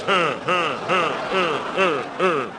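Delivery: limp, evenly spaced "huh" grunts; rhythmic grunts. Acted. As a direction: grunt as if jolted over and over. Imitates being humped by the bear.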